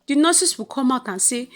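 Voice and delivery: usual speaking voice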